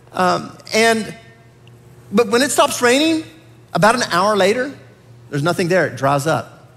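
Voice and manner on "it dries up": ordinary talk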